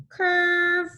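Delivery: high, loud sung-out curve; broad singsong kids theatre. Shown as a demonstration. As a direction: sing the word up high for kids. Teacher guiding letter stroke.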